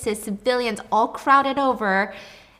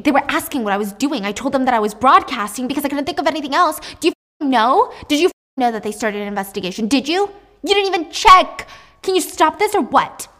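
building furious shout, louder and higher; screaming; 5 semitones higher, 6 dB louder than usual. Acted. Eve yelling at the corrupt police chief, furious. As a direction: rage at him down the phone. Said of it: "And she's screaming".

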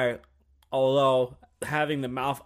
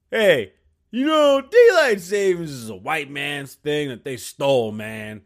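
loud mock rant; 4 semitones higher, 11 dB louder, slower than usual. Comedian voices an aggressive Native guy.

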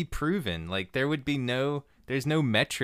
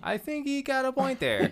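put-on Milwaukee accent. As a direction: sound like a good-natured Milwaukee guy. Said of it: "like, a Milwaukee accent".

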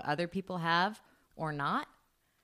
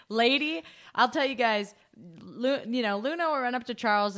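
ordinary talk

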